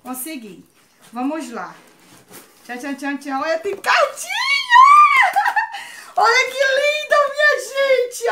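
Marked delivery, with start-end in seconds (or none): 3.4-8.3 high, loud squealing cry of delight with a laugh; overjoyed squeal. Real, not acted. Vlogger opening her first gift squeals over a handmade letter, overjoyed and loud. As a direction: burst out shrieking with joy at the gift.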